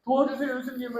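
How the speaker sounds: high, hushed little plea for help; anxious plea. Acted. Royal official asking angry Jesus for help, nervously. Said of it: "막 안절부절하는 거".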